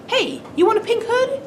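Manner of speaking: very high-pitched storybook grandma; high, playful granny voice; grandma character voice. Acted. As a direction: pipe it up high as a cartoon granny.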